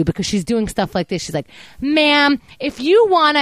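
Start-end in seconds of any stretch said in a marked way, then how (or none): 1.8-3.4 higher and louder, a snippy curt "Ma'am"; condescending agent. Retold or imitated. Retelling how the fraud rep talked down to her, snippy. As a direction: imitate the rep's snotty scolding tone. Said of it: "copying the way you're talking to me".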